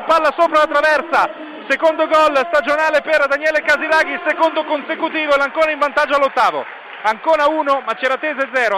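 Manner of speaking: screaming goal call, high and loud; goal commentary. Real, not acted. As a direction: yell the goal with full lungs. Goal scored, live radio call.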